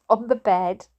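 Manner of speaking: ordinary delivery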